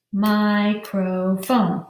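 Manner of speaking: "Microphone" slowly, in three separate beats; "Microphone" stretched into three even, slow syllables; syllables pounded out. Shown as a demonstration. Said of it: "pound out the syllables".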